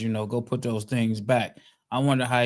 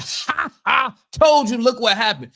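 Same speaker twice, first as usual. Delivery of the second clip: gloating; 10 semitones higher, 5 dB louder than usual. Retold or imitated. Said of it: "with a whole bunch of".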